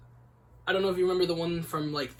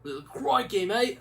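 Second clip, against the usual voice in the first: put-on Australian accent; 5 semitones higher, 5 dB louder, slower than usual. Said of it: "I butchered that accent".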